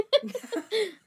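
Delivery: sudden high giggle; high laugh. Real, not acted. Laughing at own morbid joke.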